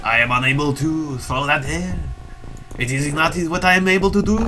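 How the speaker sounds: put-on French accent; Bernard Revenue, French accent. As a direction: play a soldier with a hammy French accent. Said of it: "I'm imagining he's French".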